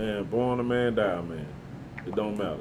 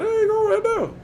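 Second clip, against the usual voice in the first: excited recognition. Retold or imitated. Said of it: "they were like".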